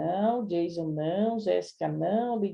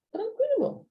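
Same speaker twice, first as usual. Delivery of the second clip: "tranquilo" sung out much higher; breezy reassurance; slower than usual. Real, not acted. Teacher telling students the answer is easy, breezy. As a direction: swing it up light, like no problem at all.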